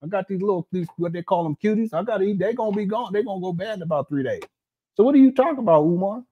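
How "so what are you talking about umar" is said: calling out. Real, not acted. Calling out Umar loudly.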